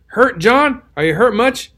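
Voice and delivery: a light calling-out, not full volume; retold yell. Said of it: "everybody yelled out to him".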